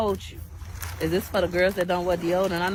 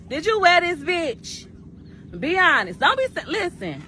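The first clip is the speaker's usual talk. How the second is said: indignant scolding; 7 semitones higher than usual. Real, not acted. Scolding Miss Red over a used wig, indignant and higher. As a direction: call her out like she wronged you.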